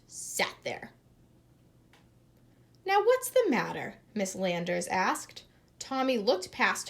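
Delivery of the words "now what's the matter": higher impatient question; exasperated teacher. A narrator's reading. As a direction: demand it impatiently.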